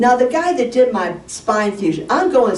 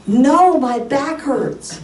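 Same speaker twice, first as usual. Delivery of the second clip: exasperated protest; 7 semitones higher, much slower than usual. Retold or imitated. Patient protesting to a doctor who asks about home, exasperated. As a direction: drag out a pained, insistent complaint.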